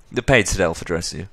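ordinary delivery

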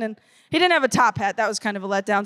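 normal talking voice

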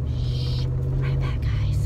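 deep hushed shushing voice; low conspiratorial shush; secretive low voice. Pretend. Shushing to keep the surprise.

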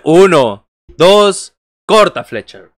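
cuenta de tres a viva voz; narrador de lucha libre. Acted. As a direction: canta el conteo como comentarista. Counts the pinfall aloud. (conteo de lucha libre en voz alta)

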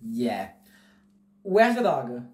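ordinary delivery

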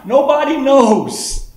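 high, slow marvelling cry; marvelling exclamation. Acted. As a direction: let the wonder lift it up high and slow. Preacher's climax on the secret.

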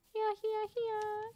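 high, coaxing here-here; high calling voice. Real, not acted. Gamer calling an animal over, high coaxing call.

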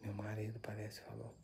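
breathy whispered aside to the camera; barely audible ghost-hunt whisper; hushed whisper. Real, not acted. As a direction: whisper it so the spirit isn't disturbed. Whispering a spirit's answer to viewers.

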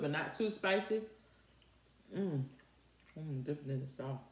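talking while chewing; eating chicken between words; mouth full. Real, not acted. Seen 2: Bites chicken at -5, then mouth closed and working while picking meat.

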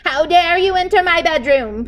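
high, loud outraged cry; indignant king. A narrator's reading. King outraged at the cook entering his bedroom, high and loud. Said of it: "cried the king".

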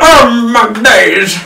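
high, loud, slow drawn out oh my days; hammed-up exclamation. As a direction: stretch it out like a theatrical lament. Repeats mock exclamation.

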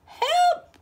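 very high, loud cry for help. Acted. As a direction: scream for help in a panic. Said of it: "cried the witch".

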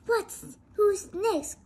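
high, loud call to the crowd. Acted. King calling out to the villagers, high and loud. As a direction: call out to the next in line. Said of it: "called the king".

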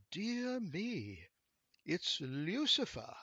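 high start, slowing on the name; a touch of prim amazement; mildly astonished gentleman. A narrator's reading. Long-nosed gentleman sees Lucifer, mildly astonished.

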